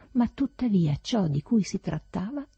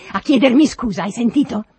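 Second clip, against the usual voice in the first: loud, high, insistent shout; angry demand; 6 semitones higher, 12 dB louder than usual. A narrator's reading. Master withholds wages until servant apologizes, angry demand. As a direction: demand it at full voice.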